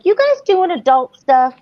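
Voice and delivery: put-on high-pitched questioning child voice; imitated young son. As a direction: imitate a curious kid asking his parents. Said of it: "our son walked in".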